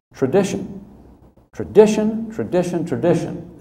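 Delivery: each tradition pushed up high; building on tradition; repeated for emphasis. Retold or imitated. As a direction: hammer tradition again and again. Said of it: "I said".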